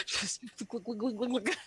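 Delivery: imitated glugging of wine; playful mock guzzling sounds; comic gulping noise. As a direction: glug it like fast drinking. Mimics fast wine drinking after laugh.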